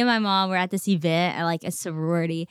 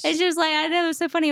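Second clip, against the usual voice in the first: laughing; 10 semitones higher, faster than usual. Real, not acted. Mom agrees, laughing.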